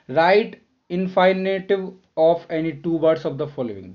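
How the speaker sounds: very slow, clear read of the instruction; slow reading aloud. Shown as a demonstration. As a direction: dictate the instruction to the class. Reads instruction slowly. (बहुत धीमे पढ़ते हुए)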